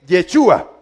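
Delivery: Yechua said as "ye-CHU-a"; Yechua, opening on "ye"; vowel variant. Shown as a demonstration. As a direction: put an "e" in the first syllable of Yechua. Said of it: "Y podés decir".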